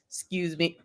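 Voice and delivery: coughing, very quiet. Real, not acted. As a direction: cough and apologize. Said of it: "hold your breath".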